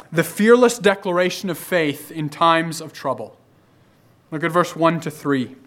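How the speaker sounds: ordinary delivery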